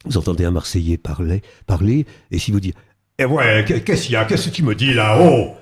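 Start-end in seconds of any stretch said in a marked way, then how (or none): 3.2-5.5 northern Marseille accent. Shown as a demonstration. Showing the interviewer a northern Marseille accent, guttural and throaty. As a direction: bark it out like a tough northern Marseille guy. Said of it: "C'est guttural, il vient du Nord".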